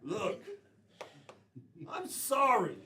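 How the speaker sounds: ordinary talk, nothing marked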